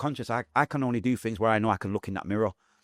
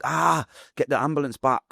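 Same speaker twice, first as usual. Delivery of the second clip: higher-pitched cry for help, retold; groaned "ah", then a raised plea; mock shout; 6 semitones higher, 3 dB louder than usual. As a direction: call out for the ambulance in pain. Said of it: "Obviously, I'm not shouting that out".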